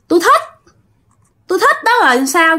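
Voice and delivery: put-on high, sulky girl's voice; defiant ghost girl. Ghost girl answering the medium, in a high, sulky voice. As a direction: toss it back high and petulant.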